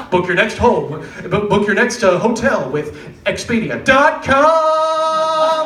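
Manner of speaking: mock ad read leaning on "next"; wrong emphasis. Shown as a demonstration. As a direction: stress on "next" instead of "home". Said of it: "put the emphasis on the wrong part".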